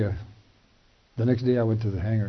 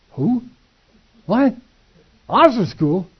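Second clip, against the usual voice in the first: much higher, louder stunned "Who? What?"; stunned disbelief; 10 semitones higher, 8 dB louder, slower than usual. Retold or imitated. Hearing he's recommended for officer school, shocked outburst. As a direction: blurt it out, floored by the news.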